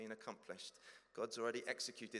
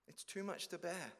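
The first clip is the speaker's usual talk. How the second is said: higher, louder pleading believer; anxious confession; 9 semitones higher, 3 dB louder than usual. Acted. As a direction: plead nervously, afraid of rejection.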